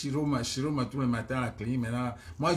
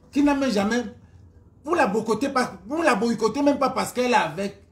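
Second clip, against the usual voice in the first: indignée; 5 semitones higher, 4 dB louder than usual. Real, not acted. Indignée qu'on boycotte Lolo.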